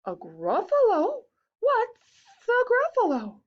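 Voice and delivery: quick animal back-and-forth; curious animals. Acted. Snake and mouse talk Gruffalo, high voices.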